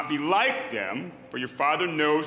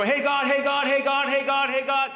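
high, repeated pestering call to God; nagging child to God; 9 semitones higher, 4 dB louder than usual. Retold or imitated. Preacher mocks praying like a pestering kid, high and insistent. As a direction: nag God like a child who won't stop. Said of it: "You don't have to go".

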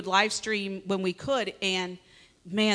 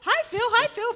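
high, singsong imitation of greetings; teasing, high-pitched repeated hello; mimicked greeters. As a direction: mimic fans calling out in a very high voice. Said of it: "every time I would walk out".

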